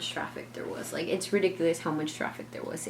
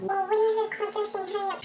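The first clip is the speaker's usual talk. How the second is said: much higher squeaky retelling; mock frantic self. Narrator mimics herself pressing the clerk, much higher. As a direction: replay her own panic, pitched way up. Her own retold outburst.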